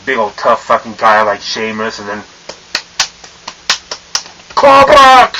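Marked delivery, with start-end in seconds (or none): 4.5-5.3 sudden high, loud yell; mock wrestling move shout. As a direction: belt out the move name like a hammed-up battle cry. Imitates wrestler's signature move shout.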